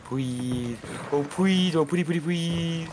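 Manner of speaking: high whiny pleading, drawn out; high-pitched mock begging. Player begging a reluctant teammate for help, squeaky mock pleading. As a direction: beg squeakily in a put-on little voice.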